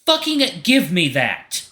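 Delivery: high, loud, slow furious demand; vicious snarl. Acted. Artemis storming up to Leo, slow furious snarl. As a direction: snarl it as you lunge for it. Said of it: "beak splitting in a vicious snarl".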